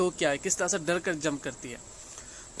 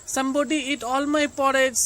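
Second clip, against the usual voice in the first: put-on slow, higher baby bear voice; little teeny voice; 6 semitones higher, 6 dB louder, much slower than usual. A narrator's reading. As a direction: sound small like a baby bear. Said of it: "in his little teeny voice".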